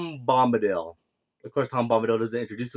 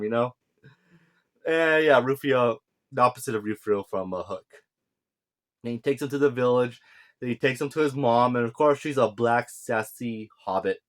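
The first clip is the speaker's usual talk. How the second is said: usual speaking voice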